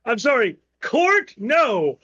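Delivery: ordinary delivery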